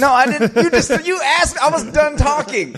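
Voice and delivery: high, protesting squeak; indignant squeal. Pretend.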